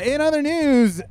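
slow anchor voice, drawn out; news anchor. Shown as a demonstration. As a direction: intone it like TV news.

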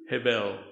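"Hevel" said the Hebrew way, low and measured; Hebrew pronunciation. Shown as a demonstration. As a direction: pronounce "Hevel" as HEH-vel, in a low voice. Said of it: "how to pronounce it in Hebrew".

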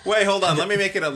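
hurried in-character appeal; urgent plea. Acted. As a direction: call out to stop someone walking off. Character stops an offended stranger leaving.

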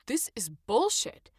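frustrated outburst at the unfair fine; fed-up complaint rising into a loud objection. A narrator's reading. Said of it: "Adam said in frustration".

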